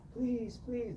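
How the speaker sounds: high pleading please; clown begging. Retold or imitated. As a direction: beg in a high small voice. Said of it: "the clown is begging".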